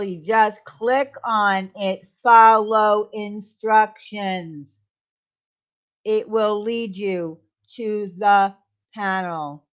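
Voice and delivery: very slow, spaced-out words; slow, deliberate instructions. Real, not acted. Host walking Crystal through joining, slowly and deliberately. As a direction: spell it out slowly, word by word.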